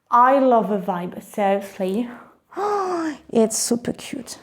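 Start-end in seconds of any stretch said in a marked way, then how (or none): 2.6-3.3 high, quiet gasp of delight. Real, not acted. Unboxer loving the bag's vibe, delighted gasp. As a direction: draw in a soft, high gasp.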